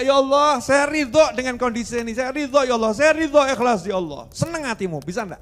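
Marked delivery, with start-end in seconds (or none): 0.0-4.3 loud cry of acceptance. Acted. Man with broken leg cries out loudly to God.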